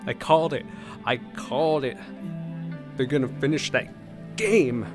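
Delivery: gleeful, emphatic I-told-you-so; triumphant. Real, not acted. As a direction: crow over being proved right. Triumph at correct prediction.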